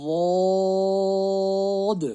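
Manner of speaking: واد chanted long and thick, high and loud; teacher modelling a thick ضاد; heavy stretched letter. Shown as a demonstration. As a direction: recite ضاد heavy, no ز sound. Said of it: "موٹا پڑھنا ہے اور کھینچ کے".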